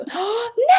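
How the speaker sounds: sharp delighted gasp; excited gasp. Real, not acted. Streamer spots a favourite viewer in chat, gasping. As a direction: gasp in happy surprise.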